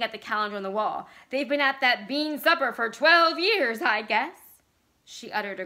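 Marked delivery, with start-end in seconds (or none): 1.3-4.4 loud, raised-pitch jeer; shrill outburst. A narrator's reading. Vicky pointing at the old calendar, shrill and loud. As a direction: push it out loud and high.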